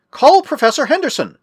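high-pitched urgent call; shout. A narrator's reading. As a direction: shout an order with pitch jumping up. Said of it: "shouted Mark".

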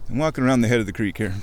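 ordinary delivery

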